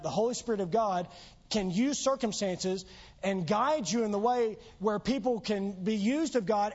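normal talking voice

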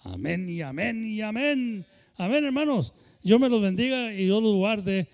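amén exclamado, más agudo y fuerte; amén gritado. Real, not acted. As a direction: proclama el amén con júbilo a la congregación. Closing the prayer, calling out. (pastor exclama amén y bendice a los hermanos)

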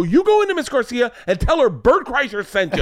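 high, loud mock command; retold brash order. Father ordering someone to go tell Miss Garcia, brash high order. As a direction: announce yourself with high, swaggering volume.

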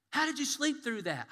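high, loud disbelief; family asking the sleeper, loud and amazed; amazed question. Retold or imitated. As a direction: marvel out loud.

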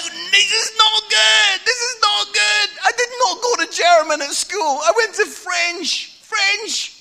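hammed-up terror at the top of the jump; mock panic. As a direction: squeal it like a man panicking on a cliff. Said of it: "I'm like".

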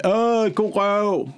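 slow, drawn-out mock admiration; ogling imitation. Imitating women admiring a backside, slow mock ogling. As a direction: stretch out the leering "oh". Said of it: "gør det der med".

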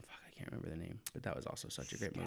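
hushed, quick whisper. Real, not acted. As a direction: drop to a whisper. Trying to recall a title.

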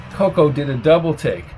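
ordinary delivery

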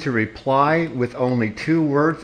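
firm, slow, every word separate; slow and deliberate. Real, not acted. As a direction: spell it out with stern patience. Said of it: "I am going to ask you again".

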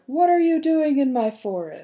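loud, high monster roar; bunyip roar. A narrator's reading. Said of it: "he roared".

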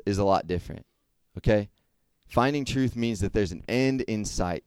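ordinary talk

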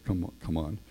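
soft invitation. Acted. Jesus inviting Simon to breakfast.

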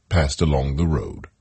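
usual speaking voice

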